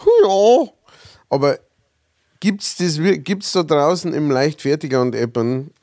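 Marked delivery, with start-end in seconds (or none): 0.0-0.7 high, loud martial arts cry; karate shout. Retold or imitated. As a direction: bark out a kiai battle cry. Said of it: "hört man immer".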